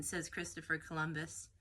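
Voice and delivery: plain reading voice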